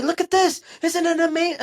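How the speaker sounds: loud, high imitation of gushing fans; mock amazement. Host mimicking people who send him clips all night, mock excitement. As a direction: shriek it like an overexcited follower.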